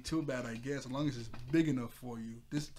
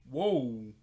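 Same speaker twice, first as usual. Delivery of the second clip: louder sudden real startle; startled whoa; 7 dB louder than usual. Real, not acted. Reacting to the TV volume being way too loud, startled. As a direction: jump at the blaring volume. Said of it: "really surprised me".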